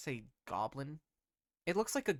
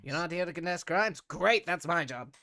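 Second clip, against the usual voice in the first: higher, fast mock surprise; sarcastic goblin; 6 semitones higher, 8 dB louder than usual. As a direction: tall goblin mocking the party's answer, sarcastic.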